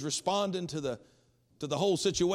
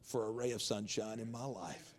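softer, lower voice, a touch of tears; hushed, moved telling of his thanks; retold weeping; 5 semitones lower, 8 dB quieter than usual. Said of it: "And he started weeping".

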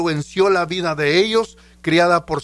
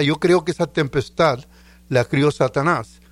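ordinary talk, nothing marked

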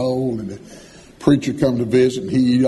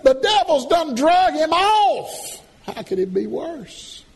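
put-on frantic young son. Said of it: "Marcel said".

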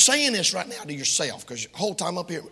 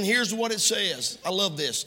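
ordinary delivery

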